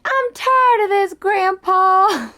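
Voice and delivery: put-on a weary girl whining to her grandpa; tired Ellie. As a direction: squeak it out like a worn-out girl. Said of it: "Ellie's like".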